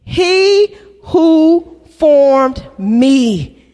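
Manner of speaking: very slow, every word weighted; slow emphatic recital. Shown as a demonstration. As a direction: model it for the church to echo. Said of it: "Use the word me".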